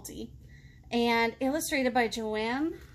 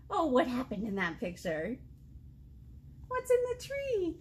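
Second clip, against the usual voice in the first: ordinary delivery, nothing marked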